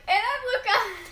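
slow, drawn-out copy of a sister's intro; imitating Luca. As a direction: mimic her slow greeting. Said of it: "she's just like".

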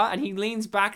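ordinary talk, nothing marked

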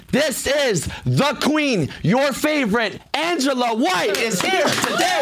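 slow, grand announcer build-up; hype introduction. Acted. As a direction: announce her like royalty. Hyped guest introduction.

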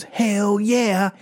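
put-on high-pitched gleeful kid call; mock defiant cheer. Kid answering the principal, high mock cheer.

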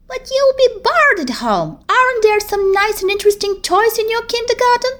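put-on a small girl tempting her friend with toys; little girl voice. Vicky's voice in the story.

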